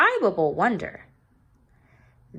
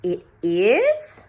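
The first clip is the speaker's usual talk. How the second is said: louder, taken aback; child startled by the teacher's remark; surprised question; 8 dB louder than usual. Acted. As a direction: caught off guard by the teacher. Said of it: "said Jo".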